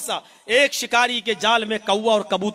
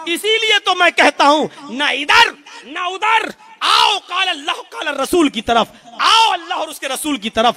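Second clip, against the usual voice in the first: fiery preaching; 7 semitones higher, 5 dB louder than usual. Acted. Preacher calling the congregation to Allah, loud and high. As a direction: summon the crowd toward God and the Prophet.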